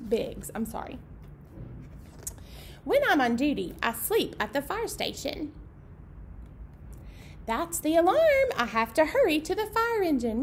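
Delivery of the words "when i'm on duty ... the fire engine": lively picture-book read-aloud; reading to children. A narrator's reading. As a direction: read it out brightly for the little ones.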